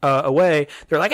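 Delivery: ordinary talk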